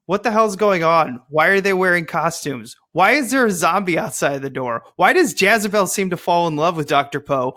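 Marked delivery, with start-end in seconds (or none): none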